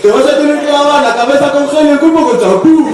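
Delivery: high, loud jeering wisecracker; mocking joker. The joker mocks the hairy guy, high, loud jeers. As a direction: taunt him like the class clown. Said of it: "al graciosillo de turno que te dice".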